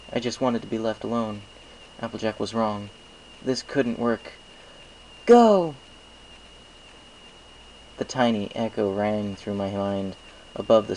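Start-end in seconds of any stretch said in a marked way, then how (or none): 5.3-5.8 sudden loud high shout; a voice from a dream snapping him out; echoing command. A narrator's reading. Voice echoing in mind.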